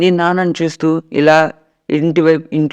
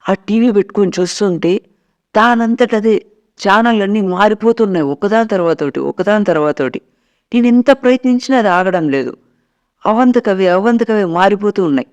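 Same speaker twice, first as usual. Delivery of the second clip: retelling it in a mildly frightened, higher voice; a hint of a scared mother recalling the haunted TV; 5 semitones higher than usual. Mother telling her son about the TV, mildly frightened. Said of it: "అమ్మ ఈ మాటలు చాలా భయపడుతూ".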